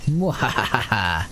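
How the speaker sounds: slow hammed-up villain laugh; spelled-out evil cackle, slowly; mad scientist laugh. Mock evil plan laugh.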